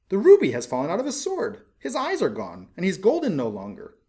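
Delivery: a fussy mayor scolding the statue; indignant mayor. A narrator's reading. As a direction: splutter with pompous outrage. Mayor complains about statue.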